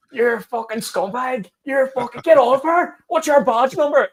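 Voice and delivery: high, mounting protester outrage; imitated screaming. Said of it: "you're screaming at them going".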